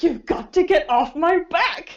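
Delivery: slow, hammed-up teen protest; mock overacting. Mocking the actor's line as overacted, slow and hammy. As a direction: drag it out like a melodramatic teen. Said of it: "You mean, like, he's overacting?".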